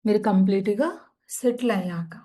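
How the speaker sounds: calm, unhurried meditation guide; soothing yoga nidra guidance; guided meditation. Acted. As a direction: ease listeners into stillness.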